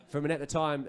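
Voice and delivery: usual speaking voice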